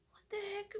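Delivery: high, breathless whisper of alarm; a stunned hushed what the heck; shocked whisper. Retold or imitated. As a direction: gasp it out under your breath. Sees the cat's torn fur.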